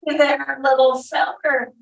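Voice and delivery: high, hearty boom. A narrator's reading. Burly soldier greeting little Jephthah, booming. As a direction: boom a greeting at a small child. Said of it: "the burly man boomed".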